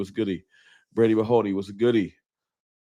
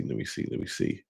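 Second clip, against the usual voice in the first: whisper; 13 semitones lower, 11 dB quieter than usual. Real, not acted. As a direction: let me see trailing into a lower, breathy whisper. Scanning the chat, trails to whisper.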